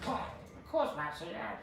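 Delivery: high, breathless goblin servant. Acted. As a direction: squeak it out like a tiny goblin. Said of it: "That was my, uh, goblin voice".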